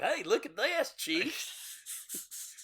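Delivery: high, strained goofy cop voice; dumb cop quote. Retold or imitated. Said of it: "and he's like".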